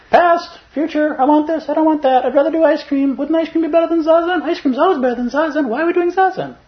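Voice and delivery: very high, squeaky chattering brain voice; chattering monkey mind. Pretend. Meditator mocking her own distracted mind, squeaky and high. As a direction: play the restless mind begging for ice cream. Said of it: "three-ring circus that lives inside my head".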